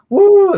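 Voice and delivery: high, loud imitated whoop; cheering whoop. Retelling the Australian jumping up, cheering whoop. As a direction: whoop it out like a celebrating winner.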